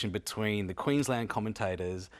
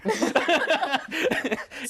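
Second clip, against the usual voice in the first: loud, high burst of laughter; real helpless laugh at being put on the spot; embarrassed laugh; 8 dB louder than usual. Real, not acted. Pressed again to do the accent.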